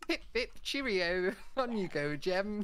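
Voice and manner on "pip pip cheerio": proper English accent, slow and drawn out; hammed-up prim English accent. Shown as a demonstration. Showing friends an English accent before Jem's turn, very English. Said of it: "I've gone very English".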